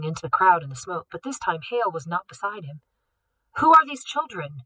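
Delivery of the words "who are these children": higher, louder sharp demand. A narrator's reading. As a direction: put the guards on the spot. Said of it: "Gregory Wainwright demanded".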